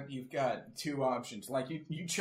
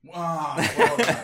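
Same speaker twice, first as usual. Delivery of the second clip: excited praise; 4 semitones higher, 12 dB louder, slower than usual. Real, not acted. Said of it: "praising the story".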